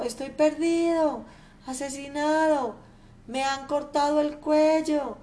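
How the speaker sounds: the robbed miser yells the alarm; frantic shouting. Acted. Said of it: "llega gritando desde el jardín". (gritos desesperados, voz más aguda)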